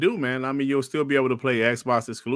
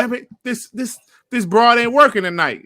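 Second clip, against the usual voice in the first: much higher, a hammed-up annoyed gripe; mock frustration; 11 semitones higher, 5 dB louder than usual. New PC gamer cursing his computer, hammed-up annoyance. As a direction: grumble like a fed-up gamer at his PC.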